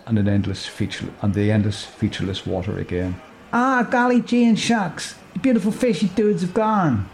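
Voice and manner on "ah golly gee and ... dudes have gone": lamenting Moonbeam. Acted. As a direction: wail it like a soppy dreamer mourning the fish. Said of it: "laments Moonbeam".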